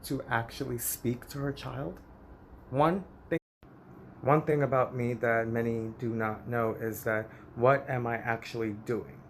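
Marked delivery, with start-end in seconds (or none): none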